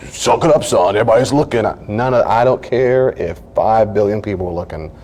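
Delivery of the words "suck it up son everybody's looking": higher, pushed mock scolding; mock hard-nosed coaching; tough dad. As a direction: act the father hushing a crying son. Mocks a hard-nosed father.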